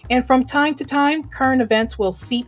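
loud, bright show opener; radio announcer. Acted. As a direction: sell the intro with energy. Show intro over music.

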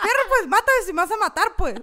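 high, loud defiant dare, laughing; fierce. Retold or imitated. Daring the shadow figure at night, loud and fierce. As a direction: taunt the shadow, fearless. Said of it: "Así como bien fiera".

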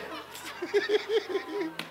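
high laugh. Real, not acted. Preacher laughing at his own chant, high laugh. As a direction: let a high laugh escape.

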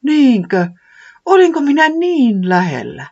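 high startled disbelief; astonished. Acted. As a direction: marvel that home was so near. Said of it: "Villavallaton hämmästyi".

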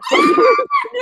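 a high, loud burst of a shrieking laugh. Real, not acted. Laughing out loud at buying glasses for every friend, shrieking. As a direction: screech with delight. Delighted at her own Christmas plan.

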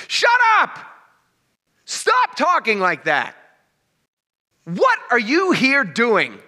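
put-on priests yelling at kids. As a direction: bark it at the children, then drag out the scolding.

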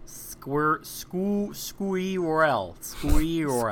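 squeerel said as skwee-rel, stretched; mock mispronounced. Shown as a demonstration. Mocking the list, he mangles squirrel as squeerel. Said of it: "That's how you say it".

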